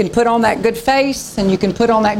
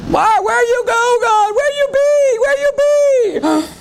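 very high, comic whining at God; mock a sulking believer calling out; whiny believer; 13 semitones higher than usual. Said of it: "Isn't that what we do?".

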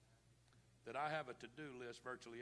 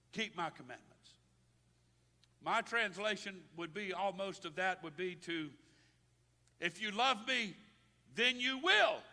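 loud, higher-pitched preacher's delivery; full-force preaching; 6 semitones higher, 12 dB louder than usual. Real, not acted. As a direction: proclaim it to the whole congregation.

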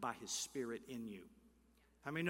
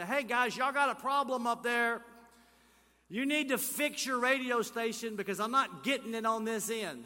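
loud, higher-pitched caller griping; mock complaint call; 7 semitones higher, 7 dB louder than usual. Listener calling the radio station about static, loud mock complaint.

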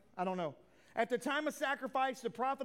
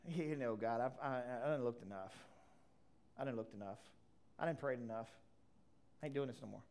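weary complaint; 11 semitones lower, 7 dB quieter, faster than usual. Retold or imitated. Voicing a weary, discouraged believer.

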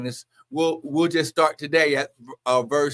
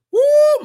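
high, loud, drawn-out whoop; ecstatic whoop; 12 dB louder than usual. Real, not acted. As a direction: let out a piercing church whoop. Ecstatic whoop while preaching.

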